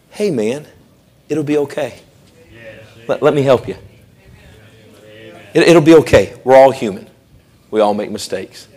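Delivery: hushed kind consoling; gentle reassurance. Acted. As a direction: lift someone up tenderly. Comforting a fallen friend.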